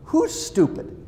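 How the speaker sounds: high, teasing question; wife overhears her husband muttering and teases him; wife asking, amused. Retold or imitated. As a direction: catch him talking to himself. Wife asking from next room.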